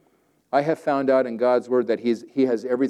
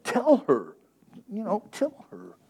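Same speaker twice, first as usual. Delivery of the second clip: whining Martha; 9 semitones higher, 3 dB quieter, slower than usual. Acted. Martha voiced, pictured scene.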